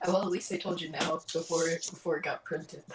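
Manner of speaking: usual speaking voice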